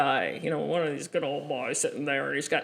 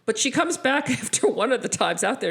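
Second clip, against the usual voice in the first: usual speaking voice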